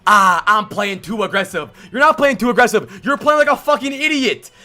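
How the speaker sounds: angry rant. Real, not acted. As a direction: yell it out, fed up with his feeding. Ranting at player's bad play.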